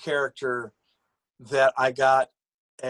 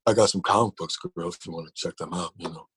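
smooth pickup voice; 6 semitones lower, faster than usual. Retold or imitated. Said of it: "He sound like the guy girl from, uh, Super Jail".

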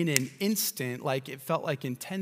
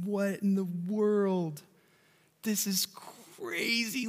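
slow, higher, awed wonder; marveling; 8 semitones higher, slower than usual. Retold or imitated. New father holding his newborn child, slow marveling wonder. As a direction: breathe it out in amazement, slowly.

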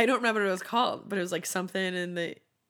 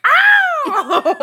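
high, loud funky yelp; [name] shriek; 14 dB louder than usual. Retold or imitated. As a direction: belt a [name] 'Ow!'. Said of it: "he's just like".

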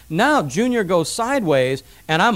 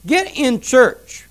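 slow, higher, emphatic command; firm parental order; 6 semitones higher, 4 dB louder, much slower than usual. Acted. Parent to a teenager refusing church, firm slow order. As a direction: lay down the law word by word. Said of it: "what you need to say to that kid".

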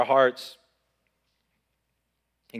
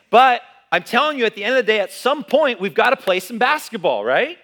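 loud, high, driving preacher climax; a fired-up pastor shouting the point; preaching at full force; 11 semitones higher, 9 dB louder than usual. Acted. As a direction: build the room up and hammer it home.

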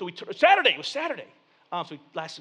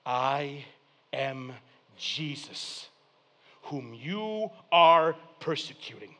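low, measured divine answer with pauses; voice of Jesus; 6 semitones lower, slower than usual. Acted. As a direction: answer gravely, word by separated word. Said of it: "And the voice says".

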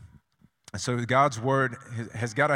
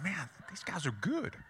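quiet aside; 6 semitones higher, 10 dB quieter than usual. Retold or imitated. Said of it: "I was like".

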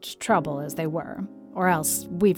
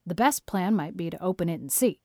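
curt; 2 semitones lower than usual. A narrator's reading. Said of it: "said Marilla curtly".

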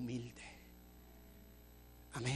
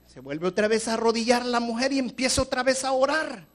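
ordinary talk, nothing marked

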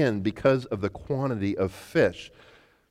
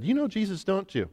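put-on servant girl; 8 semitones higher, 4 dB quieter than usual.

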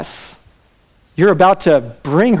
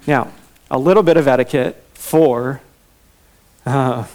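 usual speaking voice

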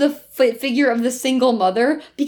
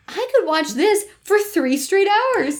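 excited; 7 semitones higher than usual. Retold or imitated. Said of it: "I said in my notes".

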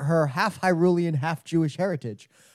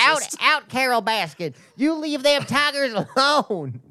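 high, drawn-out Southern exorcist; hammed-up Southern banishing cry; Joe Exotic; 9 semitones higher than usual. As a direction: cast them out in a high twang. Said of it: "like, Joe Exotic exorcist".

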